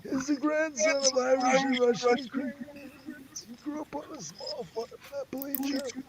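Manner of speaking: squeaky high reading voice, slowed; high silly voice. Pretend. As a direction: read the bio in a silly high voice. Reads bio in silly voice.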